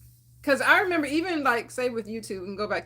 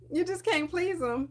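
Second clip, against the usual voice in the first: higher, emphatic exasperated complaint; 5 semitones higher than usual. Retold or imitated. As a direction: stress can't in "you just can't please 'em", exasperated. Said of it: "I'm just like".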